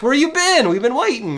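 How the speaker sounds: lightly mocking impatient friends; friends' voice. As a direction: pitch up to mock the waiting friends.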